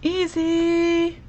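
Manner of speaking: a high, drawn-out easy; sing-song easy. Real, not acted. Crafter tells viewers how simple the card is in a sing-song voice. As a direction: make it sound effortless.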